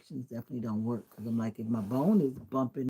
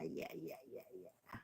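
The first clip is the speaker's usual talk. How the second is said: whispered, trailing yeahs; under-breath yeahs; 6 semitones lower, 18 dB quieter than usual. Real, not acted. To chat, a whispered trailing yeah.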